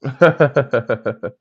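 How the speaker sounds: low rapid-fire chuckle; a run of short, even laughs. Real, not acted. Streamer breaking off mid-thought to chuckle, laughing.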